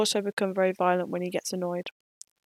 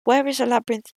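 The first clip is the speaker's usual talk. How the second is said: character voice; 4 semitones higher, 4 dB louder than usual. Acted. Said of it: "questioned Adet".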